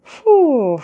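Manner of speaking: high, falling exhaled "whew"; exasperated whew. Real, not acted. Job seeker on French taxes, exasperated whew. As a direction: let out a long whew at the cost.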